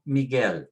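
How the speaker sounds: Miguel as mee-GEL, weight on the end; last-syllable stress. Shown as a demonstration. Teacher puts stress on the last syllable, final stress. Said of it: "put the stress on the last syllable".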